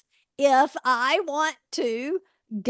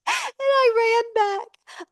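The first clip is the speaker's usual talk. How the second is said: high, squeaky retelling through giggles; rolling with laughter; 11 semitones higher, 3 dB louder than usual. Real, not acted. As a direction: barely get the story out for laughing. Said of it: "I am rolling".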